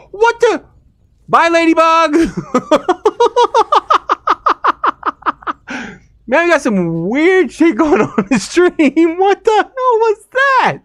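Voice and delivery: high, loud, laughing exclamations; excited disbelief. Real, not acted. Streamer laughing loudly at a spider yeeting a ladybug. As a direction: cackle and shout in delighted disbelief. Laughing at bizarre game moment.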